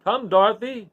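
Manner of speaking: the wizard calling Dorothy to hurry to the balloon; crying out. A narrator's reading. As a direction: call her over the crowd. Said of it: "cried the wizard".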